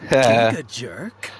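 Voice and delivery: laughing, loud and slow, mocking the insult; loud amused scoff. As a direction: chuckle it back at them with scorn.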